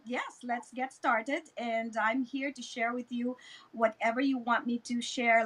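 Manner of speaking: usual speaking voice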